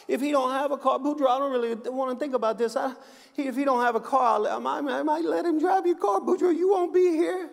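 high, quick put-on wife voice; hammed-up wife reasoning it out matter-of-factly; wife explaining to her husband, high and quick. Wife answers in joke.

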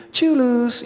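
high sing-out taunt at a loser; playful gloating. Pretend. Believer looks down on the devil, playful gloating.

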